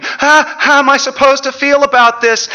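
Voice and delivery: high, loud anxious question; urgent high-pitched pleading question; panicked child. Acted. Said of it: "She's got panic in her eyes".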